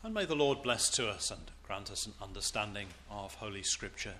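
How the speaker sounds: low, hushed closing prayer. Real, not acted. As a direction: pray softly over the reading, voice dropped. Short prayer after the reading.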